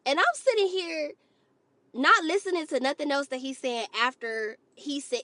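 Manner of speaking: usual speaking voice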